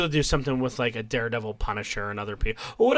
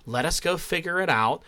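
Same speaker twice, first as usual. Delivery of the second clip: calm advice; 4 semitones lower, 6 dB quieter than usual. Retold or imitated.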